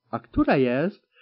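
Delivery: plain reading voice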